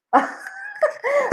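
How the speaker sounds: high, soft giggle; a light high-pitched laugh. Real, not acted. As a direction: giggle at herself. Laughs at herself.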